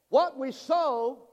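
loud, high and drawn out; preacher's call-out. Real, not acted. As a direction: slowly proclaim it for all to repeat. Leads congregation in saying it.

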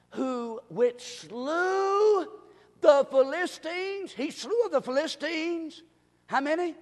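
ringing pulpit delivery; emphatic preaching. Real, not acted. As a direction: preach the verse out, stretching the key words. Preaches on the verse.

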